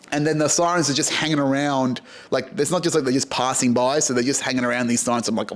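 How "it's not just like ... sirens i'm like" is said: usual speaking voice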